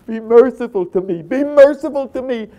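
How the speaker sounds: high, screamed plea for mercy; anguished shouted begging; desperate crying out. Acted. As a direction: wail for mercy at full force. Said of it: "She's crying".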